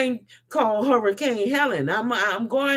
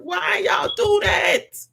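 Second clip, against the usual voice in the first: higher, drawn out why; exasperated cry out; 6 semitones higher, slower than usual. Real, not acted. Vlogger demands why people block him, exasperated.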